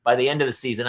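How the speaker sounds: usual speaking voice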